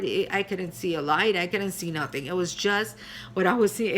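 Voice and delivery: ordinary talk, nothing marked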